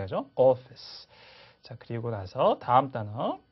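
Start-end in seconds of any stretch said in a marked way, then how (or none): none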